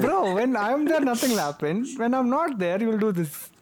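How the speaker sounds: high, mocking whiny friend voice; mock Vishnu. Friends teasing about Vishnu's presence, high mocking voice. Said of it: "Just like".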